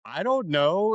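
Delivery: mock dumbstruck reply; stunned stammer. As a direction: stammer it out as if caught with no answer. Acting out his stunned reply.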